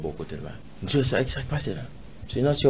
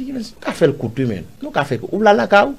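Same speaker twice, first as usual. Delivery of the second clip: much higher, louder acted exchange; retold heated insistence; 7 semitones higher, 9 dB louder than usual. Man re-enacting argument with officer over the house, heated. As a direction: push the point hard, voice jumping up high.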